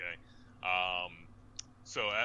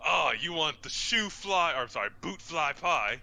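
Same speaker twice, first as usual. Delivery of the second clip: loud bartender; 9 semitones higher, 12 dB louder than usual. Acted. Bartender mishearing order.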